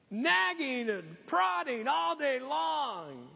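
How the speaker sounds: high, slow, stretched-out words; emphatic preaching. Real, not acted. Preacher relishing the verse about nagging, emphatic. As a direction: draw out each word for comic weight.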